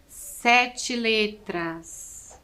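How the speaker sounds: very slow Sete letras, leaning on Sete; slow emphatic answer. Shown as a demonstration. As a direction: stretch out Sete so it sinks in. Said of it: "teacher stresses the answer". (bem devagar, Sete letras)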